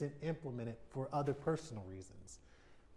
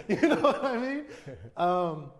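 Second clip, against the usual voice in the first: higher and louder, laughing through it; still laughing; 6 semitones higher, 14 dB louder, slower than usual. Real, not acted. To the interviewer, words spoken through real laughter. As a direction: ask it while still laughing.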